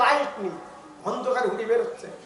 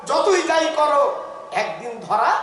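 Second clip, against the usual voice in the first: loud, higher fiery warning; preaching shout; 5 semitones higher, 5 dB louder than usual. Real, not acted. Preacher warning the tricksters, a loud fiery shout. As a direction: thunder it at the crowd.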